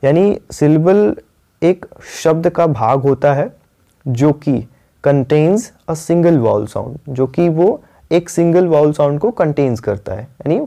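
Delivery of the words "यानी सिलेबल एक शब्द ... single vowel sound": ordinary talk, nothing marked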